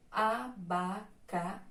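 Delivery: "abacaxi" split slowly into a-ba-ca-xi, stressed on final xi; syllable by syllable. Shown as a demonstration. Teacher showing the class the stressed syllable, syllable by syllable. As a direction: sound out "abacaxi" in separate syllables, landing on xi. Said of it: "a sílaba que tem um som mais forte".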